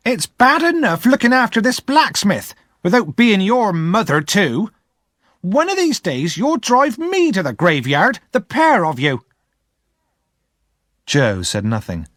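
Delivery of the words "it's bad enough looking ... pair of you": put-on scolding older sister. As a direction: berate the boy, pitched up high. Missus Joe complaining.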